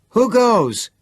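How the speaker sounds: a high, loud challenge; yell. Acted. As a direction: yell it at the trap door. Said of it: "Chris yelled".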